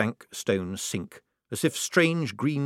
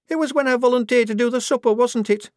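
put-on much higher, louder young woman; anxious, caught out; Shufti, girlish voice; 6 dB louder than usual. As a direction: recruit confessing in washroom.

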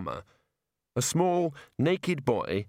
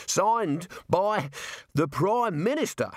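higher, broken up by gasping pauses; winded, forcing it out; out of breath; 7 semitones higher, slower than usual. A narrator's reading. Jailer catching up with Rincewind, out of breath. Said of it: "fought for breath".

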